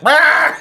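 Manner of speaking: high, loud comic roar; mock roar. Imitating sore losers turning extremist, with a mock roar. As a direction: burst out in a cartoon rage noise. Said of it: "then they're like".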